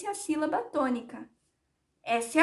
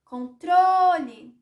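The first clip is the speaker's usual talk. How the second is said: "Controle" called higher, loud, with TRO held long; slow, loud call of "Controle"; 4 semitones higher, 7 dB louder, much slower than usual. Shown as a demonstration. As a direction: shout out "Controle" as if calling it, stretching "tro". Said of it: "fingir que eu estou chamando o controle".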